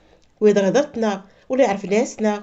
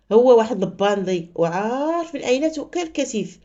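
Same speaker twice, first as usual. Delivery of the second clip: usual speaking voice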